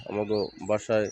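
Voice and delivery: ordinary talk, nothing marked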